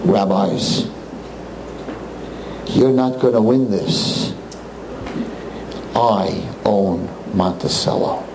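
low, slow menacing boss voice; a hint of a gangster boss, low and slow; menacing owner. Owner threatening rabbis from the top of the steps, low and slow. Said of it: "he says".

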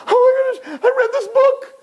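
high, squealing fake sobs; hammed-up weepy reader; mock crying. Girl overcome by a book she read, mock crying. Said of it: "people reading books and crying".

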